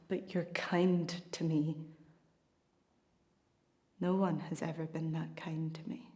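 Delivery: tender whisper. Acted. Woman to her friend, tender whisper. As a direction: breathe it out as a confession.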